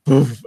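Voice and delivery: loud, high "woof" burst; abrupt puffed-out noise; imitated whoosh. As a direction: sound effect of furniture shooting away. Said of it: "ottoman just went".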